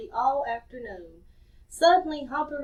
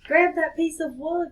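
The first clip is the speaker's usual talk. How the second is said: called out; 4 semitones higher, 6 dB louder than usual. Acted. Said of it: "called Scamp".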